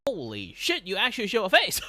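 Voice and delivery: high, loud mock amazement; astonished viewer. Viewers seeing the streamer's face, loud high mock shock. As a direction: imitate a stunned fan, breaking into laughter. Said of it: "people look at me and be like".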